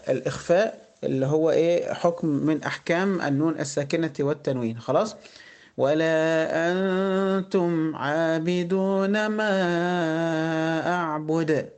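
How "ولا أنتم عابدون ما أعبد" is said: very slow tajweed chant; Quran recitation. Shown as a demonstration. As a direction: recite with long held vowels and nasal hums. Recites verse. (تلاوة مجوّدة بطيئة)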